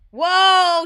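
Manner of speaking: loud, high whoa; big, loud burst of amazement; amazed. Real, not acted. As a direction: blurt it out in awe. Seeing the huge werewolf.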